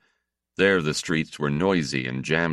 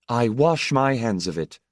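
a louder, sharp declaration; furious; 6 semitones higher, 4 dB louder than usual. A narrator's reading. Fed-up wife to her husband, furious. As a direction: throw the words down in anger. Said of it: "Missus Benot said furiously".

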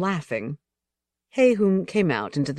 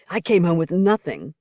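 rising, accusing; 2 semitones higher, 4 dB louder than usual. A narrator's reading. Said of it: "Rollo cried, his voice rising, accusing".

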